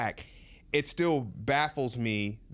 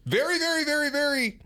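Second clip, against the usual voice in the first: high, emphatic repeated very; 13 semitones higher, 4 dB louder than usual. Real, not acted. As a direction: pile on the very, pitched up. Emphatic build on pro-capitalism.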